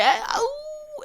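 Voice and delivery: high, squeaky alarmed "ah, oh"; mock startled yelp. Startled reaction when led to drugs.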